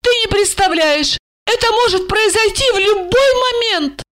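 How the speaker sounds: high-pitched anxious pleading; tipsy woman working herself up; frightened drunk woman. A narrator's reading. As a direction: beg for company in a high, urgent voice. Drunk woman begs him stay close.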